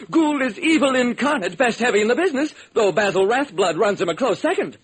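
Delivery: a director whose star just walked out; frantic Hollywood director. Acted. Director panicking over his star, in a high frantic voice.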